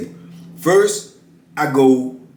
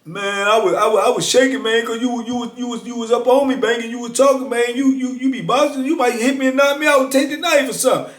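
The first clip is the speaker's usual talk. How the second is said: pitched way up, anxious and rushed; nervous, high excuse; 9 semitones higher than usual. Retold or imitated. Key explains the knife to the speaker, nervously, high. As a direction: sound scared of being knocked out.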